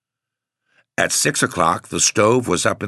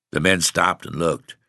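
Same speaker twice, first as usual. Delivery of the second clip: ordinary delivery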